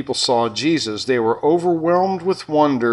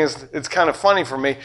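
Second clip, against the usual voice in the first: ordinary delivery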